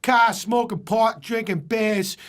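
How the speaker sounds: broad Boston delivery, words stretched for comic effect; Boston accent. Acted. Telling a bar story about unpronounced R's, Boston accent. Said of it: "there's Boston. ... they don't pronounce the letter".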